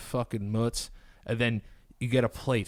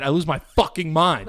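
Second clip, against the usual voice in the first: ordinary delivery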